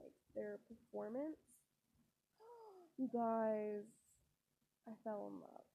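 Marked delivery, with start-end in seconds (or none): 2.4-2.9 sudden delighted gasp, breath drawn in; sharp gasp. Real, not acted. Vlogger gasping at a dazzling idol performance. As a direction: gasp as if struck by love at first sight.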